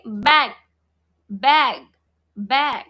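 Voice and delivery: "Bag" repeated firmly with a hard G ending; teacher's model "Bag", one word at a time; hard final G. Shown as a demonstration. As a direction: punch each "Bag", closing on a hard G. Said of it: "We don't say batch".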